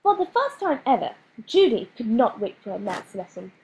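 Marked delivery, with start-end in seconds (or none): none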